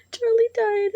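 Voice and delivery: real tears, pitch cracking up high; crying. Real, not acted. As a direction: voice breaking into a high sob. Telling the dog Charlie died.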